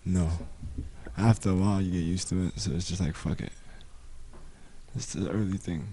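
soft, low, sexy late-night voice; hushed like a phone-line host; low hotline voice. Real, not acted.

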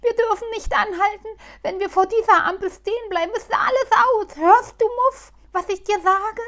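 high, loud, fast begging; pleading. Acted. Halbschuh begging Muff not to stop at the light. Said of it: "sagte Halbschuh flehend".

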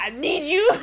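high, loud mock plea; hammed-up needy demand; insistent cat. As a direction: cry out as the cat demanding notice.

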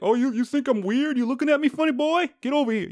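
tough guy challenge. Retold or imitated. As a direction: taunt like a brawler itching to fight. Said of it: "If someone's like".

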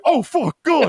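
high-pitched panicked cry; mock alarm. Party guests see the shotgun, mock panic. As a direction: imitate a crowd spotting danger.

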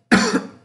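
a short real dry cough; coughing fit. Real, not acted. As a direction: cough once, unvoiced. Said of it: "Desculpa, desculpa a tosse aqui".

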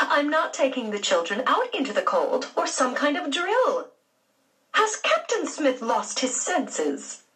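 a hint of an indignant lady; indignant aunt. A narrator's reading. Aunt Daisy refusing Henry's drill, indignant.

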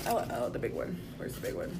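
hushed, breathy search; whispering. Real, not acted. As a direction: whisper it to yourself. Searching for the big chocolate.

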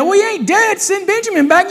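high-pitched mock objection; protesting retort. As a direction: pipe up in protest at being written off. Acted retort to Jacob's writing off.

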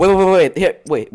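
loud, high, urgent wait; urgent interruption. Real, not acted. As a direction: cut in loudly, excited.

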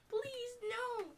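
high-pitched pretend whimper. Acting out a clip's victim, whimpering. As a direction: plead in a small, high whimper. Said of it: "go whimpering voice".

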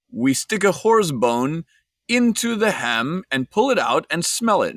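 hammed-up, Borat-like Italian accent; thick Italian accent. Guide explains how ham is checked, in a mock Italian accent. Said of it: "he says in this thick accent".

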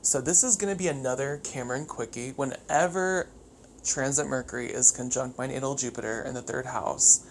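normal talking voice

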